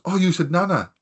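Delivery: high-pitched questioning surprise; imitated surprised question. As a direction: echo it back like a surprised local lad. Acting the lad's surprised question.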